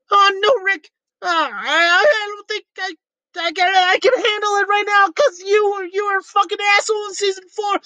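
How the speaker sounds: put-on high, whiny stammering teen; nervous cracking boy voice; Morty voice. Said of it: "my interpretation of Rick and Morty arguing".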